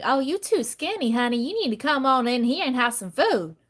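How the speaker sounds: put-on fussing Southern lady with a lilting accent. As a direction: coax a guest to eat in a Southern accent. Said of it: "when a Southern lady is like".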